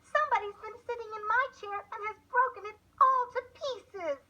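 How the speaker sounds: little bear piping in dismay. A narrator's reading. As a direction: squeak it out like a small upset cub. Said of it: "the little bear piped".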